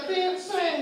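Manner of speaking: high teasing call; mock taunt. Street kid teases the narrator, high sing-song jeer. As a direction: jeer at him from the roadside.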